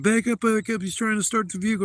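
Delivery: high, loud, fast panicked yell; panicked shout. Retold or imitated. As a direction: shout a warning to the crowd to back off. Crowd panicking at leaking car.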